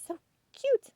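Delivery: high, loud delighted squeal over cute dogs; excited squeal. Real, not acted. Shopper squealing at cute dog decor, high and delighted. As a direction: squeal it out like you just spotted puppies.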